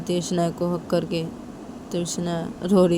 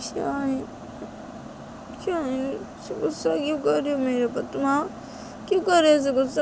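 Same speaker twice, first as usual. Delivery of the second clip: whiny, protesting self-defence; pleading Shanaya; 9 semitones higher, 4 dB louder than usual. Acted. Shanaya justifies herself to Tisha, pleading plaintively. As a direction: plead your case to a sulking friend.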